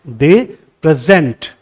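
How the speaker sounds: slow "They present", weight on ZENT; second-syllable stress. Shown as a demonstration. Teacher demonstrates the verb, "present" stressed on its second syllable. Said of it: "तो ज यहाँ stress दे रहा हूं".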